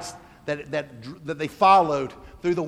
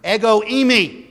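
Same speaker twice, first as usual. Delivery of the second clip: Ego eimi pitched up and slowed; declared Greek; 6 semitones higher, 5 dB louder than usual. Shown as a demonstration. Preacher proclaiming the Greek I am, raised. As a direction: ring out ego eimi with weight. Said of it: "he uses the Greek word".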